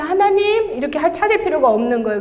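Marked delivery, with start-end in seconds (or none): 0.0-0.7 high, drawn-out imitated call; calling up to God. Testifier imitating her old searching prayers, calling out to God. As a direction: cry out skyward, pleading for attention. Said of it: "이렇게 하늘 보면서".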